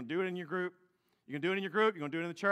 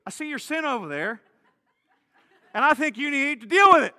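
much louder, laughing, hammed-up scolding; laughing mock rebuke; 7 semitones higher, 11 dB louder than usual. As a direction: lecture a brother while laughing at it.

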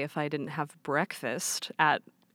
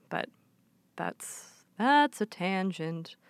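ordinary delivery

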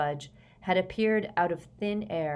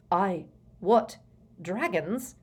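mildly startled, broken-up splutter; slower than usual. A narrator's reading. Prime Minister hears of imported dragons, spluttering. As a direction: stumble over the words in disbelief. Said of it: "spluttered the Prime Minister".

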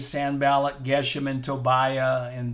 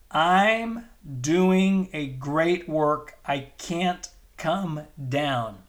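slow, every word weighted; slow emphatic declaration; much slower than usual. Acted. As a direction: proclaim it firmly, word by word.

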